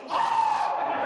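sudden very high, loud pained yelp; mock scream waking from a foot cramp; retold scream of pain. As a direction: yelp like a cramp just hit. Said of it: "I was like".

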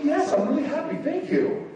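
high-pitched, restrained mock delight; unemotional happiness. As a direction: insist you are happy without gushing. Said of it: "I'm not really an emotional guy".

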